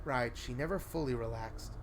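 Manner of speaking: plain reading voice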